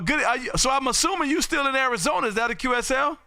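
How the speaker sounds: normal talking voice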